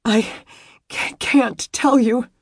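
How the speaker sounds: high, stammering tearful voice; miserable wail. A narrator's reading. Distraught woman refusing to explain to him, miserable wail. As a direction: choke and stumble on the words, wailing. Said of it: "she wailed miserably".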